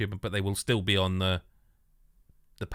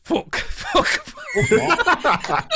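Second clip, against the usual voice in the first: laughing swears; 5 dB louder, much slower than usual. Real, not acted. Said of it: "laughing breakdown in the accent".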